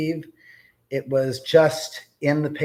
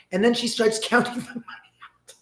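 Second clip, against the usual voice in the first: ordinary talk, nothing marked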